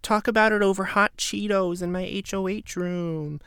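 high, airy imitation of her; lightly mocking a timid offer; imitating a meek housemate; mock sweet voice. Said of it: "she's like".

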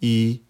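I held high and perfectly level; first tone on I. Shown as a demonstration. Teacher showing learners Mandarin I, high level tone. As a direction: say I long, high and even. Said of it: "Je les répète".

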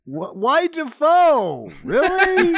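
high, drawn-out squeal of laughter; laughing incredulously; helpless laughter. Real, not acted. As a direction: squeak it out while cracking up. Cracking up at the goblin impression.

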